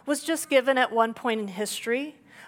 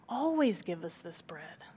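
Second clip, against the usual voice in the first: hushed, breathless plea; a soft whispered request for bread; pleading whisper; 5 semitones lower, 8 dB quieter than usual. Retold or imitated. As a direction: whisper it like begging.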